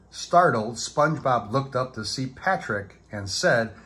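usual speaking voice

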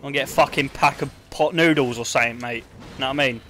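mock local Cockney, matey; Cockney accent. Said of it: "Can I do my best Cockney?".

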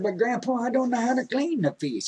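put-on high, worried boy's voice; a grandson who doesn't know how; young grandson.

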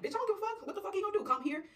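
high, loud, riled-up friend; defiant. Acted. Friend brushing off the bailed-out man, defiant.

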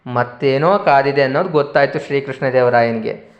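ordinary delivery, nothing marked

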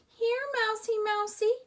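high coaxing sing-song call. A narrator's reading. Lena coaxing the hiding mouse, high sing-song. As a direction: coax the mouse out. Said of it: "called Lena".

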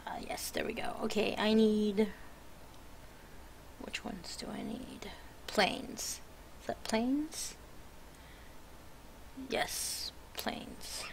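muttered whisper while concentrating; whispering to herself. Real, not acted. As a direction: whisper it under your breath.

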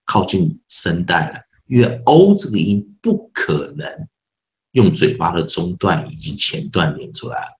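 ordinary talk, nothing marked

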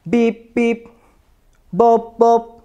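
beeping robot sounds. Shown as a demonstration. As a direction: beep like a toy robot. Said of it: "Bergerak seperti robot".

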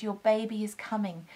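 ordinary talk, nothing marked

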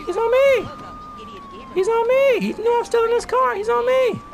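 hoch und laut gerufen, panisch; panicked shout. Real, not acted. Panics as cop chases.

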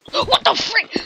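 high, loud startled cry; sudden high-pitched disbelief; startled shock. Acted. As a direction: yelp it in alarm. Startled in acted skit.

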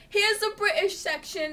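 high, loud mock British lady; British accent. Imitating a shopper in the grocery store, high British accent. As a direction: call it out in a put-on British accent. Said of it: "so I did a British one".

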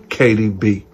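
usual speaking voice

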